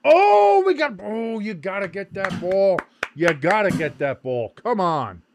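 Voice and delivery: loud, high cry easing down; match-watcher's excited yell; excited sports fan. Real, not acted. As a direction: urge the players on, drawn-out opening shout. Urging the team during live match.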